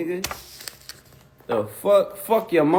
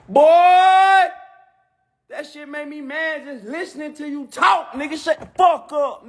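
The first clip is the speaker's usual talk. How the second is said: higher, louder hammed-up yelling; 10 semitones higher, 3 dB louder than usual. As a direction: bark at him in the rapper's voice. Impression shouting, ranting about talking.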